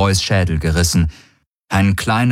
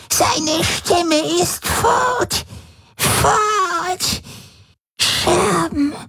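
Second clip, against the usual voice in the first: much higher, slow, wailing and half laughing; howling, laughing, whimpering; much slower than usual. A narrator's reading. Shattered old Voi wails to Ylana, crying and laughing. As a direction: come apart between sobs and a crazed laugh. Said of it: "Sie heulte auf, sie lachte, sie wimmerte".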